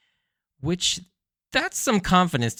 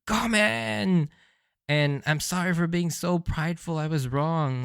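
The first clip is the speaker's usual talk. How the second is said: higher, tearful mock plea; emphatic apology; 6 semitones higher than usual. Reviewer voices Usopp begging the crew, mock tearful. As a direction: beg forgiveness, hammed-up sobbing. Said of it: "a loud and emphatic".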